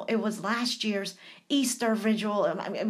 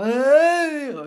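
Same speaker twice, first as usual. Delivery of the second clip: mock wail; 5 semitones higher, 10 dB louder than usual. Mock bawling outburst.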